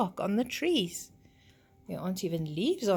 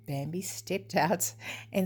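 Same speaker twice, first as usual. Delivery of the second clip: ordinary delivery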